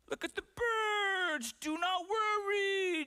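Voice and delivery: very high, drawn-out mock sing-out; mocking imitation. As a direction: stretch each word loud and high, hamming it up. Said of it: "Some of you are like".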